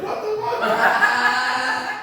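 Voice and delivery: drawn-out, high, loud mock disbelief with a laugh; mock shocked date. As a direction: stretch it out like a stunned blind date. Date's reaction seeing him.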